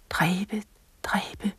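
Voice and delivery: soft, trembling echo of the word; trembling repeat. A narrator's reading. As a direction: let it shake and fade away. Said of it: "gentog han skælvende". (skælvende gentagelse, meget stille)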